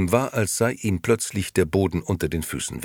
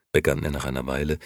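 plain reading voice

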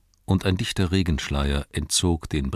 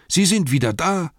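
much higher, loud excited shout; surprised call; 11 semitones higher, 4 dB louder than usual. Acted. Servant Nob calling his master at the door, excited shout. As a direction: yelp out for the boss. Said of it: "rief er".

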